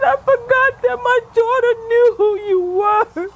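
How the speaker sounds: high, loud, broken sobbing pleas to God; sobbing prayer. Real, not acted. Woman crying out to God for forgiveness, sobbing hard. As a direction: weep out every word, begging forgiveness.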